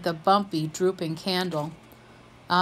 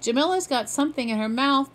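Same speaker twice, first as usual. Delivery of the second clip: hollering; 6 semitones higher, 3 dB louder, faster than usual. A narrator's reading. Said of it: "Dante hollered".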